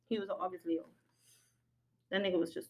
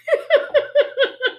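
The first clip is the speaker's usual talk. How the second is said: high burst of laughter. Real, not acted. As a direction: break into a big laugh. Laughs at her own rant.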